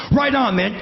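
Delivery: high, hyped-up farewell; pumped up, shaking hands far too hard; manic enthusiasm. Retold or imitated.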